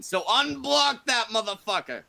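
loud, high-pitched demand; yelled order. Real, not acted. Host demands Brockus unblock Trippy, yelling the order. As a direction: yell it as a blunt command.

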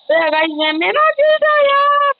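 rising into a very high squeal; excited outcry. Real, not acted. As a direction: squeal it out, stretching the last words. Crying out over his win.

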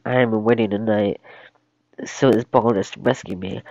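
usual speaking voice

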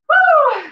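high whooping cheer; excited fitness whoop; cheer woo. Real, not acted. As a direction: whoop to pump up the class. Coach cheering at rest.

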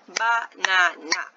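slow Banana in three even beats, BA-NA-NA; syllable by syllable. Shown as a demonstration. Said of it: "It has three syllables".

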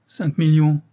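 high, loud lament. A narrator's reading. Financier faced with the huge debt, lamenting. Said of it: "se lamentait le financier".

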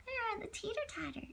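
quiet, quick murmur; soft aside. Real, not acted. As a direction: say it softly and fast.

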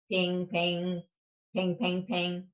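usual speaking voice

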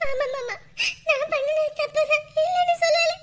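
high-pitched, sobbing admission; tearful pleading. Acted. Wife blamed by husband admitting fault, tearful pleading. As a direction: plead through tears, voice pushed high.

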